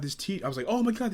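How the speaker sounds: ordinary talk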